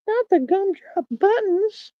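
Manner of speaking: very high, slow, tiny squeak; squeaky gumdrop voice. Retold or imitated. Streamer quoting a cartoon, very high and slow squeaky voice. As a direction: squeak it like a little cookie man. Shrek gingerbread man reference.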